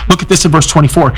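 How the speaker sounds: normal talking voice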